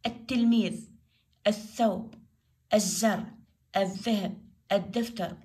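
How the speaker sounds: each word from التلميذ to الدفتر with L dropped, next consonant doubled; silent lam. Shown as a demonstration. Teacher lists sun-letter examples, lam silent. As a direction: say الدفتر as "ad-daftar", one word at a time. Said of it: "اللام ما تلفظ".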